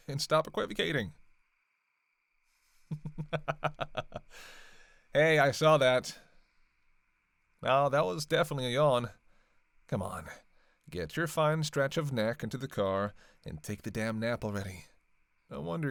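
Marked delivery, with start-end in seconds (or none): 2.9-4.8 long, rippling chuckle; teasing chuckle. Acted. Vampire laughing after blaming the hunter, teasing chuckle. 5.1-12.4 loud, raised-voice accusation; teasing call-out. Acted. Vampire catches the tired hunter yawning, loud teasing call-out.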